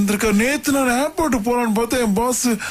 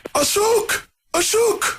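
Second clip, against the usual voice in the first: high, stretched call of Ashok; Rajini impression; 10 semitones higher, much slower than usual. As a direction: call out Ashok like a film hero. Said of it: "என்ன நடிச்சு காட்டணும் சொல்லுங்க".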